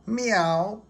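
high little meow; cat meow. A narrator's reading. Gary the snail answering SpongeBob, a high meow. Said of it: "said Gary".

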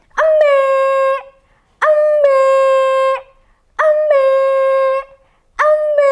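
high bleating goat voice. Shown as a demonstration. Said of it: "Ayo kita tirukan suara kambing".

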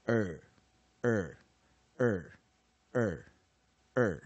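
er held long and loud, five separate times; R-colored long vowel. Shown as a demonstration. Teacher demonstrating R-controlled long vowels, slow separate er. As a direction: sustain a curled-tongue er on each repeat. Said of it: "在长母音的地方，我们就念".

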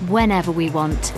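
ordinary talk, nothing marked

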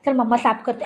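ordinary talk, nothing marked

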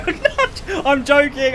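high, laughing protest; laughing backtrack. Real, not acted. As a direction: take it back through loud laughter. Laughingly takes back a jibe.

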